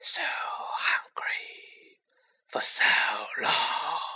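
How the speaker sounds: unvoiced, menacing whisper; cold murderous whisper. A narrator's reading. Unseen monster whispers its hunger to kill. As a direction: hiss each word like a hidden killer.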